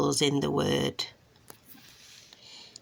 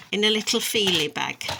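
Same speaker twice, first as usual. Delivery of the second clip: ordinary delivery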